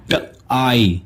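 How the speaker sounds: ट आई said as ट then आई; sounds split apart. Shown as a demonstration. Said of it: "इसका sound बनेगा".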